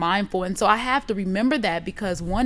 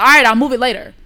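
loud, dismissive retort; irritated brush-off; 3 semitones higher, 10 dB louder, faster than usual. Retold or imitated. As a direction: shoo them off, retelling your annoyance. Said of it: "I just, like, shooed him off".

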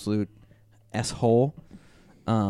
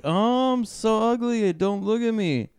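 high, whiny self-pitying voice; mocking whiny voice; 11 semitones higher than usual. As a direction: whine it in a high put-on voice. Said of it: "was like".

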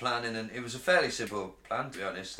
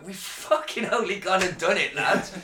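higher, louder, triumphant crowing to the crew; gleeful robber cheer; 7 semitones higher, 4 dB louder than usual. Retold or imitated. Robber crowing to his old crew after breaking through, gleeful. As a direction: celebrate like you just cracked the vault.

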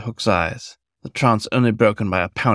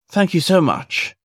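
higher, glowing with joy; beaming thanks; 9 semitones higher than usual. A narrator's reading. Fox beaming at Hook over the locket, higher and joyful. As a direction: let the gratitude spill over. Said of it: "the fox beamed".

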